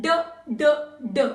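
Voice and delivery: short, separate "d" sounds; phonetic D sound. Shown as a demonstration. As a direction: sound out "d" briefly, one beat each. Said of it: "Phonetic sound of D is".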